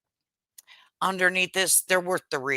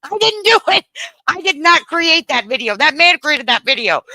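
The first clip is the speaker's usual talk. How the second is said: protesting innocence; 10 semitones higher, 6 dB louder than usual. Retold or imitated. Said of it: "I'm like".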